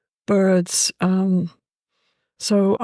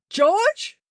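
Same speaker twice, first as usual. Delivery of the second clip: startled call; 12 semitones higher than usual. Retold or imitated. Calling the budgie by name.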